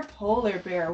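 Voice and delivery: ordinary delivery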